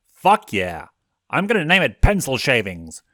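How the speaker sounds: loud, excited whoop; thrilled cheer. A narrator's reading. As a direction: cheer with glee. Thrilled cheer over gift.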